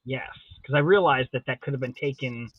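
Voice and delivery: ordinary talk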